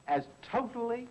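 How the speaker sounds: "totally" lifted high and leaned on; stress on totally. Shown as a demonstration. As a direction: hit the word "totally" hard. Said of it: "I emphasize the word totally".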